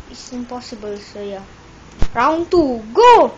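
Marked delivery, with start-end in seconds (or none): 2.1-3.3 shouting. Real, not acted. Starting round, calling out.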